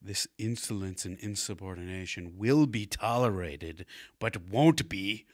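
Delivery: haughty royal, indignant. Acted. Prince rebuking insolence in his father's name, slow mock-regal scolding. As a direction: stretch the words into a grand royal decree.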